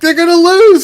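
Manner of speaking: high yell up the stairs; yelling upstairs. Retold or imitated. Yelling upstairs to his son, high excited call. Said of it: "I was yelling upstairs to my son".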